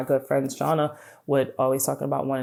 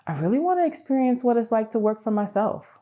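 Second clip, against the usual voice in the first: eager wish; 8 semitones higher than usual. Retold or imitated. Acting out a wish.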